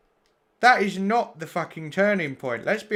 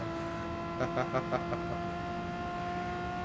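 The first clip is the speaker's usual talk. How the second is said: high laugh; 12 semitones higher, 11 dB quieter than usual. Real, not acted. Laughs at chat comment.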